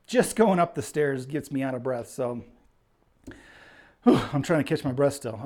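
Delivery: ordinary delivery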